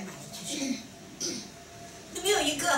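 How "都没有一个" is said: breaking into a real laugh; higher voice, tipping into open laughter. Real, not acted. Laughs at nobody raising hands. (说着忍不住笑出声)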